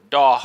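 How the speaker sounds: "doh" with the final R left off; dropped R. Shown as a demonstration. Teacher showing learners what to avoid, doh with no R. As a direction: drop the R completely, doh. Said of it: "I don't say".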